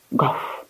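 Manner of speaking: single soft, low-key woof; puppy woof. A narrator's reading. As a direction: give one soft bark in reply. Puppy barks instead of crowing.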